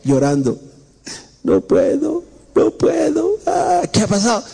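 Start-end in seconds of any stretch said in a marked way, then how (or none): 1.4-4.5 much higher, whining sobbed "no puedo"; mock crying wail. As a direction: wail it like a heartbroken boy. Joven llorando de madrugada. (llanto exagerado y agudo del joven)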